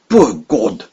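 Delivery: ordinary delivery, nothing marked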